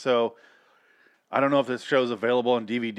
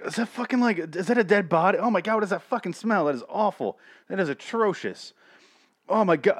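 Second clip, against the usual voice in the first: higher, hammed-up grossed-out kid; mock disgusted stoner kid; 7 semitones higher than usual. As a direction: play a high kid gagging at the smell. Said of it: "be high and be like".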